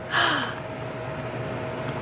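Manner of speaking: long, soft drawn-out gasp. Real, not acted. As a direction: draw in a slow audible breath. Waiting while placing the thing.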